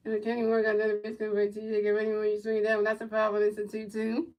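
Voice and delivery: low, quiet, steady game call; soft running commentary; play-by-play call. Acted. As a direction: murmur the pitches like a broadcaster.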